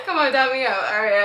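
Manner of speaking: usual speaking voice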